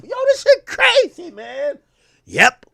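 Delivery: ordinary talk, nothing marked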